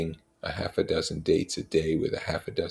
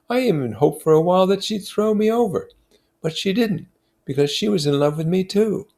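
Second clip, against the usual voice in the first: put-on higher, louder voice for a young man confessing love; a higher, louder voice, eager and a little rueful; lovesick young officer; 8 semitones higher, 5 dB louder than usual. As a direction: play the smitten officer looking back, raised and earnest. Gatsby's quoted confession.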